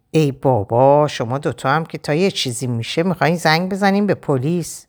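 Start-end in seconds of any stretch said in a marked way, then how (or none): none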